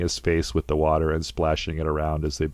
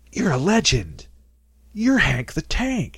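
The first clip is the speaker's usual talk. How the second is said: high, hyped-up crowd hailing a legend; cheering partygoers; 11 semitones higher, slower than usual. Retold or imitated. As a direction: call him a legend in a much higher, awed voice. Partygoers hail Hank the Tank.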